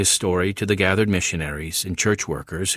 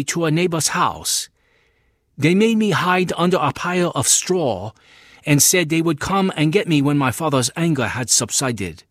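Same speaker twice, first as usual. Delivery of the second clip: put-on higher, earnest voice of a young man; young convert Chang San; 7 semitones higher, 3 dB louder than usual. Young convert tells the missionary his story, earnest and higher. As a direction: tell the missionary your ordeal, pitched up and earnest.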